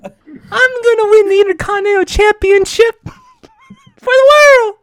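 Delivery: very high, loud, squealing champion boast; hammed-up high-pitched wrestler hype; wrestling promo impression. Joining the high wrestling impression bit.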